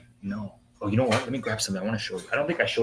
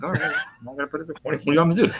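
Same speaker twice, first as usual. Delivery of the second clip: exasperated; 7 semitones higher, faster than usual. Retold or imitated. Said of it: "I'm like".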